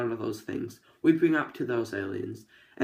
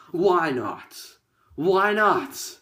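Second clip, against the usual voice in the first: excited; 5 semitones higher, 6 dB louder, much slower than usual. Real, not acted. Excited crocodile burger exclamation.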